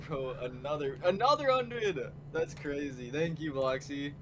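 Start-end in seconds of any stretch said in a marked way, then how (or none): 1.0-2.0 shrieking jackpot winner. Real, not acted. Second donation arrives.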